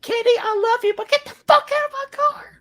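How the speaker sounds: very high, loud hammed-up outburst; over-the-top high-pitched shout; mock impassioned yelling. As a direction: yell it in a squealing pretend panic. Acted outburst at Kenny, others laugh.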